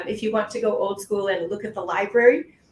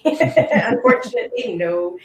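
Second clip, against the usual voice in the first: slow, rueful laugh through it; 4 semitones higher, 6 dB louder, slower than usual. Real, not acted. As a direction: laugh apologetically, drawn out. Delivering bad news with a laugh.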